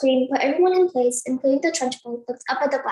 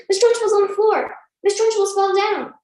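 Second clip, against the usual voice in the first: higher, louder excited shout; screaming; 7 semitones higher, 5 dB louder than usual. Acted. Front row shouts that the headmistress fell, excited shriek. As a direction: shriek the news to the class. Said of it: "the front row screamed".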